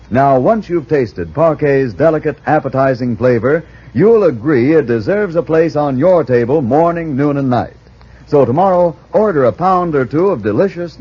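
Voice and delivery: usual speaking voice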